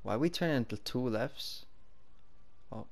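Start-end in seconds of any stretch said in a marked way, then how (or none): none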